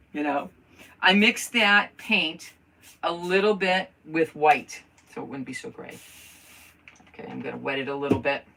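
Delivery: ordinary talk, nothing marked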